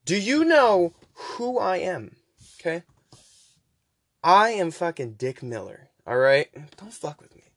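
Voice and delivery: pissed off. Retold or imitated. Janitor Walter scolding the robot, mock indignant rant. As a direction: puff up and tell the robot off. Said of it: "he looks pissed".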